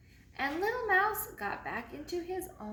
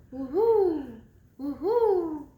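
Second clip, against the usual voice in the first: loud hooting woo-hoo; owl call; 4 semitones higher, 10 dB louder, slower than usual. Acted. An unseen owl calling outside, loud hoot. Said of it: "someone calling".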